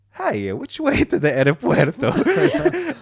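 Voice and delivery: slow, high, hammed-up Italian accent through laughter; mock Italian accent. Young Italian scammer asking for the airport, in a mock Italian accent. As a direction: ham up the Italian tourist line. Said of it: "typical Italian".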